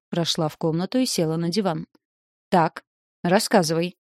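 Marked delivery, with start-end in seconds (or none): 2.5-4.0 demanding. A narrator's reading. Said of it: "потребовала она".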